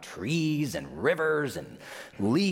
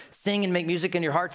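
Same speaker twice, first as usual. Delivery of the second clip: usual speaking voice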